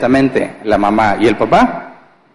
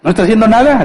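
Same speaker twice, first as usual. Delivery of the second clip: mock complaint; 8 semitones higher, much faster than usual. Acted wives complaining about husbands.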